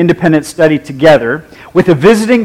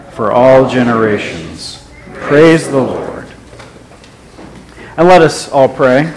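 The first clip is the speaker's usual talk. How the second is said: slow, solemn scripture and prayer voice; liturgical reading; 5 semitones lower than usual. Acted. Pastor leading the congregation in prayer, solemn and slow. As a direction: intone the psalm and prayer slowly.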